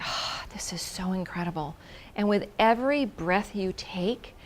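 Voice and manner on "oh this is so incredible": breathless whispered wonder; awed whisper. Retold or imitated. Gazing at the view, whispering in awe. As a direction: whisper it like you can't believe the view.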